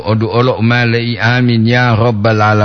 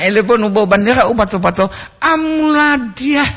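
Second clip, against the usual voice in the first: drill-command intonation; 11 semitones higher than usual. Retold or imitated. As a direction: imitate a flag-ceremony commander's high call. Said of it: "internasional hawas itu pak". (intonasi aba-aba upacara, bernada tinggi)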